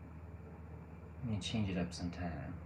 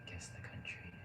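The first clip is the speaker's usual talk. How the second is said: whisper; 10 dB quieter than usual. Real, not acted. Whispering a guessing prompt.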